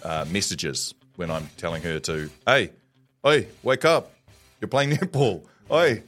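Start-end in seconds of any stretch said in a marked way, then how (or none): none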